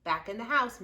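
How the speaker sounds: ordinary delivery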